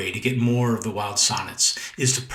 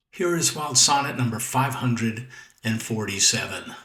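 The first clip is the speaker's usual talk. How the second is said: ordinary talk, nothing marked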